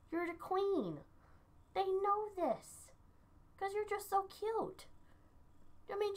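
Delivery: high, soft cooing to the cat; pet baby talk. Acted. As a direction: coo at your pet like she's a baby.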